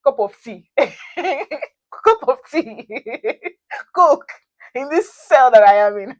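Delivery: high, loud, laughing mockery; laughing in disbelief. Mocking the wardens' tea offer from her cell, laughing. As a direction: scoff through laughter at the offer. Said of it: "In my mind, I was like".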